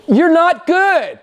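high, loud, slow declaration; emphatic rebuke. Acted. Preacher loudly tells the self-righteous they are not good. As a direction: drive home a blunt no.

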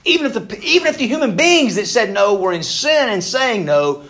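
high, loud, full-force preaching; rising to a fervent shout. Real, not acted. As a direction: hammer it home from the pulpit. Preacher building to a climax.

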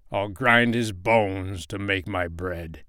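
slow, menacing giant voice. Acted. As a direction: drag the threat out slowly. Giant's rhyme ending.

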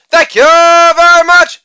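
hammed-up stage thank-you; showman sign-off. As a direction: stretch out the thanks like a showman taking a bow.

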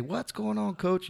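high-pitched, breezy call-out; acted coach greeting. Shown as a demonstration. Walking into a big room of coaches, hammed-up greeting. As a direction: toss out a hammed-up hello. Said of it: "Walk in and just say".

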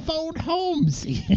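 high, slow alien croak. Acted. Riffing on the old lady alien, high slow alien voice. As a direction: join in with a high put-on alien voice.

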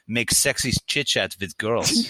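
put-on slow German-accented quote; mock German accent, drawn out; German great uncle. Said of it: "great uncle taught me how to".

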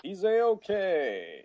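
high, drawn-out put-on voice; hammed-up, very slow reassurance; mock cartoon line. Mocks the cartoon's dubbed line.

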